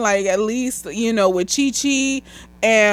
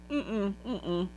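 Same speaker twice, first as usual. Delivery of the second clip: ordinary talk, nothing marked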